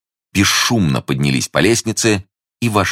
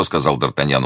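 ordinary delivery, nothing marked

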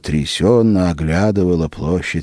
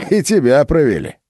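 mocking; 9 semitones higher, 5 dB louder than usual. A narrator's reading. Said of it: "хрипло и с издёвкой".

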